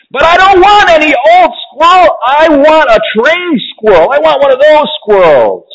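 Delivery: very high-pitched bratty demands; spoiled child's tantrum. A narrator's reading. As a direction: wail your demands like an indignant little girl.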